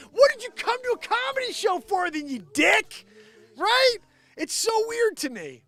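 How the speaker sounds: high, loud exasperated outburst; real rant at its peak, incredulous and shouted; angry rant. Real, not acted. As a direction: yell it in high-pitched indignation. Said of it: "I'm sorry I'm getting angry".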